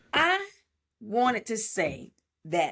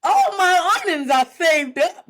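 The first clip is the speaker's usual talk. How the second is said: high, loud put-on voice of the maid; mocking maid voice; 7 semitones higher, 8 dB louder than usual. Mocking the movie maid to the co-host, high put-on voice. Voicing Annie offering savings.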